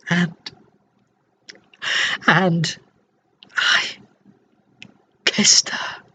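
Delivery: faltering, long breaks between words; overcome with emotion. Acted. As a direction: choke on the memory, barely getting each word out. Overcome recalling the kiss.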